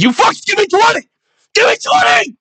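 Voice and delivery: high-pitched drill-style scream; full-throated, strained high yell. Retold or imitated. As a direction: scream orders down the phone. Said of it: "I'm just screaming".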